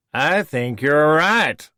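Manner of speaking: high, slow, sneering villain voice; a smooth, superior schemer taunting an old foe; Voke, smug villain. Acted. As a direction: lecture him with mocking disdain.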